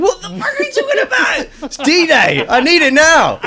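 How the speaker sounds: high-pitched panicked shout, retold. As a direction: shriek it out in mock panic over the missing video. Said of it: "I was like".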